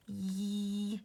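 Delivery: Yee held out long, a tickle at the back of the throat; stretched Y. Shown as a demonstration. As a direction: stretch the Y sound long and silly. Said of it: "getting stuck on the first sound in yellow".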